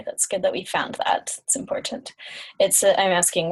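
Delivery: usual speaking voice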